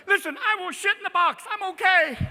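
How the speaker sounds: high, loud panicky cat voice; hammed-up high-pitched alarm; frantic indoor cat. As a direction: insist in a mock panic.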